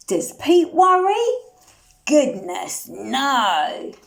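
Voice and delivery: ordinary delivery, nothing marked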